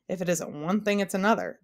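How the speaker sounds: ordinary delivery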